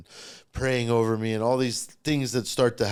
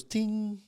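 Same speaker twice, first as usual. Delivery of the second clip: high little ting like a notification chime; imitated computer ting; 9 semitones higher, 3 dB quieter than usual. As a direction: chirp the computer alert sound. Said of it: "my computer goes".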